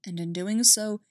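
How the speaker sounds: plain reading voice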